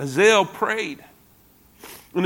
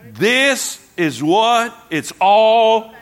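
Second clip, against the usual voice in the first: slow; 2 semitones higher, much slower than usual. Real, not acted. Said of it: "What did I tell you?".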